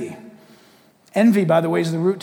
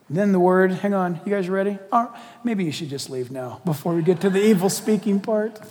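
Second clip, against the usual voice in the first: ordinary talk, nothing marked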